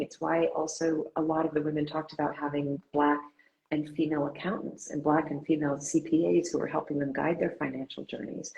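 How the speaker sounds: normal talking voice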